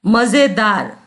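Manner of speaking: "मजेदार" said as ma-ze-daar. Shown as a demonstration. Teacher gives a nukta example, z in "मजेदार". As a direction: put a buzzing z in "मजेदार". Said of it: "तो उसका उच्चारण होता है".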